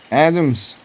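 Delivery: loud, high call of the name. A narrator's reading. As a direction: announce it to the whole crowd. Official calls first name aloud.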